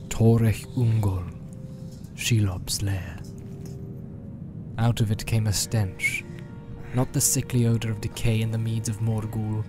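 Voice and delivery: ordinary delivery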